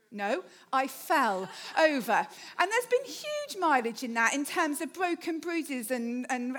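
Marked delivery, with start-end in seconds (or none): 0.1-2.3 slow, firm "No, I fell over" with weight on "fell"; stress on fell. Shown as a demonstration. Correcting people who said "your fall": firm stress on "fell". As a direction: correct them, leaning on "fell". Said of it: "I keep stressing that".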